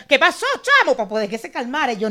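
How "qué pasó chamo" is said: loud, high Venezuelan slang greeting; hammed-up Venezuelan buddy voice; exaggerated Venezuelan. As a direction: lay the Venezuelan on thick and loud. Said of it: "casi que me ponía".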